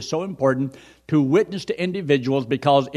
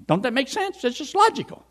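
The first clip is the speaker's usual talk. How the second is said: much higher, mocking singsong; mock sarcasm; 11 semitones higher than usual. Preacher mocks the man's logic for the congregation, sarcastic. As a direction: parody his smug reasoning.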